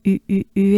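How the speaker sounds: U repeated as rounded French "ü"; three quick U sounds, "ee" through pursed lips; droopy-mouthed French U. Shown as a demonstration. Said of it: "droopy face, droopy mouth".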